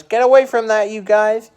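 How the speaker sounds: high, loud coach's yell imitating him. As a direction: bark it across the gym like a coach. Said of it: "this coach knew how to yell".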